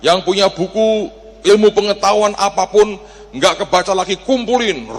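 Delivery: rally the church to bring their books; mock shout to the congregation; calling out. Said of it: "saya teriak jemaat".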